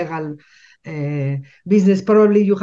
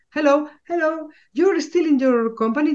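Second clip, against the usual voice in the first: much higher, bright greeting voice of the shop owner; cheerful shop owner; 11 semitones higher, 4 dB louder than usual. Retold or imitated. Speaker imitating the shop owner greeting her in the shop, high friendly voice.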